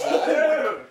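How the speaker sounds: high, loud nervous laugh; shocked laughter. Real, not acted. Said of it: "I don't know why I'm laughing".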